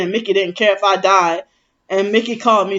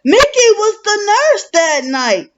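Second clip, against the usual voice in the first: much higher, quoted sister's voice; imitating twin sister; 11 semitones higher, 3 dB louder than usual. Quoting what the twin sister said, pitched up high. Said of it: "my twin sister said".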